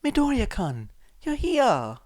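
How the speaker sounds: high, loud happy cheer; cheerful little girl. A narrator's reading. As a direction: greet him like an excited child. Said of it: "she cheered".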